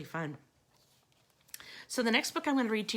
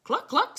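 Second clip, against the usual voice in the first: high, louder hen cluck; mock clucking; 11 semitones higher, 8 dB louder than usual. A narrator's reading. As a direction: cluck like a chicken. Chicken clucks.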